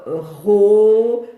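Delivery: ro sounded out loud and slow; loud clear ro; syllable demo. Shown as a demonstration. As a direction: read the card syllable ro to a child. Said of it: "où on écrit".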